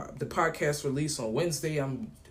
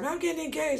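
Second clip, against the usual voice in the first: much higher, bubbling announcement; excited news; 13 semitones higher than usual. Retold or imitated. Friend telling him her engagement news, high excited. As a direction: share the big news with joy. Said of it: "She's like".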